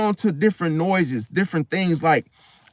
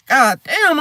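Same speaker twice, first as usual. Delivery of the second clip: amazed exclamation; 5 semitones higher, 4 dB louder than usual. Retold or imitated. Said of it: "I'm like".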